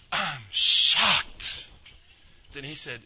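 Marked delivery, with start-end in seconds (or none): 0.1-1.6 loud, drawn-out mock shock; hammed-up astonishment. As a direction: blurt it out as if stunned. Seller's stunned reply, acted.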